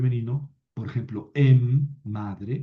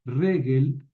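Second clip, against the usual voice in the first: Regel with the weight on RE; stress on first syllable; 2 semitones higher than usual. Shown as a demonstration. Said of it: "Ojo con el acento en la primera sílaba". (acento en la primera sílaba: RE-gel)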